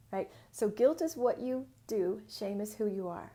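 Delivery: ordinary delivery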